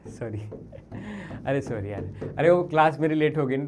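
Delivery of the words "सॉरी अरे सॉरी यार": soft, low sorry; subdued, low-voiced apology; apologetic. Real, not acted. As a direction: apologise quietly after losing your temper. Apologises after his angry outburst.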